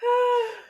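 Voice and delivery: high, loud exhale; loud sigh. Real, not acted. Resetting for another intro take, loud sigh.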